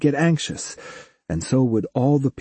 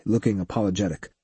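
ordinary delivery

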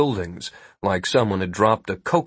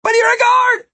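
very high, loud shout; 6 dB louder than usual. A narrator's reading. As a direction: shout it in protest while running past. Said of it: "Martin shouted as he ran past".

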